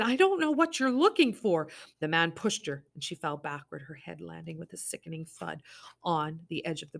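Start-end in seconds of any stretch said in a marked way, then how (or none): 0.0-1.7 terrified plea. Acted. Summer pleading, terrified.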